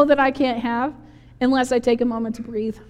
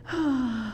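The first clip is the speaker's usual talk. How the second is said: soft, contented mock-sleep sigh; hushed long breath, imitating a sleeper; sleepy sigh; 9 dB quieter than usual. Jesus asleep on the wet cushion, serene sigh.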